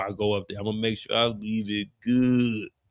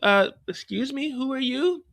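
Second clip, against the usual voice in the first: much higher, prim voice; high lady at the door; 12 semitones higher than usual. Acted. The lady asks Rock who she is, high and prim. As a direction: answer the door high and wary. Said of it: "She's like".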